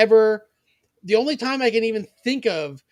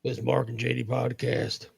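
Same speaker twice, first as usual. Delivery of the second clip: low gravelly cowboy voice; 7 semitones lower, 5 dB quieter than usual. Retold or imitated. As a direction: voice a gruff cowboy star. S1 joins gravelly cowboy impression.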